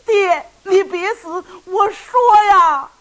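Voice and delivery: high, loud crying cry to his father; sobbing plea. Acted. Son cries out to his father not to kill himself, sobbing loudly. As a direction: beg through tears, breaking into a wail. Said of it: "雷霆也哭了".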